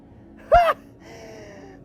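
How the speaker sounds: high, drawn-out scoffing laugh; mocking laugh. Inner voice scoffs at the idea of performing, mocking laugh.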